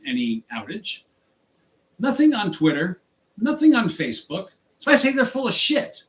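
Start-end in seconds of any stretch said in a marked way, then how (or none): 4.8-6.0 angry jab, higher and louder; furious. Real, not acted. Driver calling out Uber's outage claim, angry jab. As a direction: snap it out in real anger. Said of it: "I'm furious about this".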